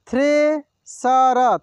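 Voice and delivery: Thresher drawn out slowly, syllable by syllable; slow sounding out. Shown as a demonstration. Teacher slowly sounds out thresher for students. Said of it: "एक एक मात्रा को अलग अलग करके".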